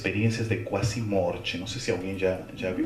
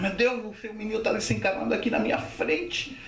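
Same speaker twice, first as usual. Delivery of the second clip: acted panic; 8 semitones higher than usual. Uncle panics watching boy drown.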